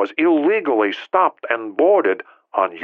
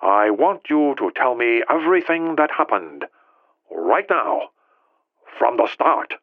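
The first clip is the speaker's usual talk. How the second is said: controlled, filtered phone voice, pausing between phrases; coldly; 4 semitones higher, 3 dB quieter than usual. A narrator's reading. President demands the whole story from Ri, coldly. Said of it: "Jin Tao said coldly".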